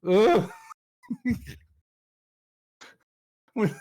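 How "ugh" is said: loud high grossed-out groan; disgusted ugh. Retold or imitated. Loki grossed out by the liquefying, a loud high ugh. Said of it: "And he's like".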